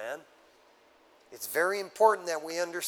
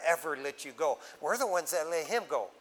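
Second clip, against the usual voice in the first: usual speaking voice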